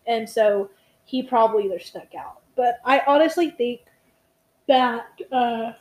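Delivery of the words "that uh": mid-yawn, words stretched. Real, not acted. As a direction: talk through a yawn. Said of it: "I'm, like, yawning so much right now".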